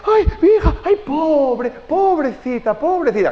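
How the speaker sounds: very high, loud, drawn-out pitying lament; fussing mother. Retold or imitated. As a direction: smother the child in pity. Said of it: "Y empieza". (madre consolando a su hija, lamento compasivo muy agudo y exagerado)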